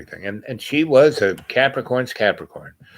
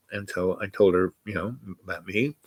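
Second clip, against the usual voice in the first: ordinary delivery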